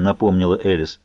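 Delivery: plain reading voice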